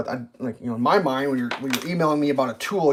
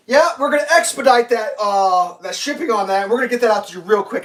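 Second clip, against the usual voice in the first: high, louder, rushed promises; mock rep voice; 5 semitones higher, 4 dB louder than usual. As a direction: imitate the agent glibly. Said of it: "they're like".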